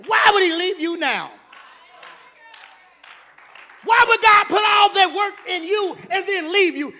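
loud, high, driving sermon climax; preaching at full force. Acted. Preacher shouting God's deliverances to the saints, full force. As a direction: shout it to the back pew, building fire.

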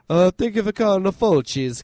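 put-on a lightly done cheesy Italian accent; bad Italian accent. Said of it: "that's the worst Italian accent".

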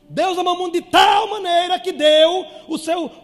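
high, loud shouted scripture; shouted revival preaching; full-force preaching. Real, not acted. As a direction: proclaim it to the whole church.

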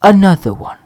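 loud catchphrase shout, lightly voiced. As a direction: boom it out like the hype man. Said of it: "if I was [name] right now, I would go".

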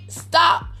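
ordinary talk

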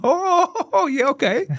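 very high, giggling mock taxi driver; knowing laugh. Taxi driver laughing at the tourists' red-light motel: high knowing giggle. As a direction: squeal it out like a man who knows exactly what that place is.

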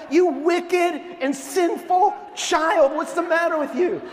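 put-on high, loud preacherly rebuke of a child; hammed-up outrage, scold with a laugh underneath; mock scolding. Mock scolding of scheming daughter.

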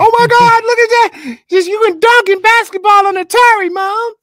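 put-on a thrilled kid calling to Mom; excited little fanboy. Little fanboy running around the house showing Mom, squealing excitedly. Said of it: "that little fanboy running around the house going".

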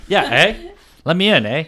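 Canadian impersonation, rising on each "eh"; put-on Canadian accent. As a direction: play up a joking Canadian accent. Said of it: "I talk like that sometimes".

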